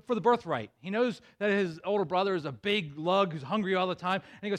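usual speaking voice

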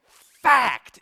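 loud, high shout of fact; yelling. Retold or imitated. Older brother shouting at his brother over the word. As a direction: bark it right in his face. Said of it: "keeps yelling".

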